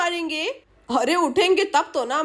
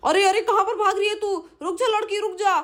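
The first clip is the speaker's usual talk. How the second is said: raised, high-pitched chasing call; calling after her; 4 semitones higher than usual. Acted. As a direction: order her to stop as you chase. Thief calls after fleeing girl.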